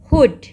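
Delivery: हुड with a brief oo; quick, short oo in हुड. Shown as a demonstration. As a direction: end the vowel of हुड at once. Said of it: "जल्दी से ख़त्म हो गया double ओ sound".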